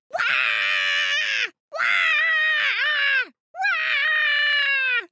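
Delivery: put-on very high-pitched baby wailing; long piercing imitated infant cries; baby's loud wail. A narrator's reading. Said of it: "yelling as loudly as possible".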